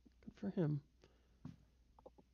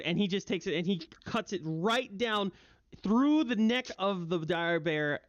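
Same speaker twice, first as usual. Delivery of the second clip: normal talking voice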